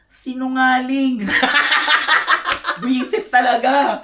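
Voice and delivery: high loud laughter through the words; laughing. Real, not acted. Calling Drea a liar to her face, laughing loudly.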